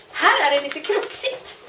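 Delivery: usual speaking voice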